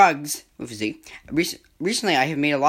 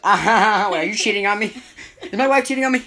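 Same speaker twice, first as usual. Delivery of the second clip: louder, rising mock alarm; mock shock; 6 semitones higher, 6 dB louder than usual. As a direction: play it as a sudden shocked realization. Acted shock in the skit.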